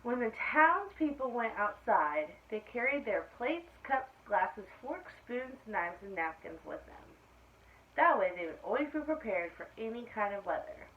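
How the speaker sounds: clear, lively storybook reading; reading to children. A narrator's reading. Teacher reading aloud to her class, storybook voice. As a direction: read it out to the class like story time. Reads picture book to class.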